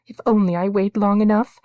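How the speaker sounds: high, loud breathless plea; girl praying while hurrying uphill; panting. A narrator's reading. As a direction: pant out the prayer. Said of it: "she panted".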